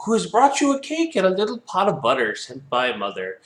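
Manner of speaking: high, put-on little girl voice; counterfeit granddaughter voice. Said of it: "counterfeiting her voice".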